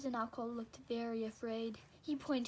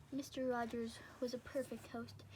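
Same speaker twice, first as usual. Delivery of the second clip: ordinary delivery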